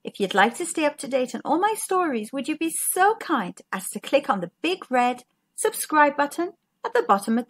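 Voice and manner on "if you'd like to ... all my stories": ordinary delivery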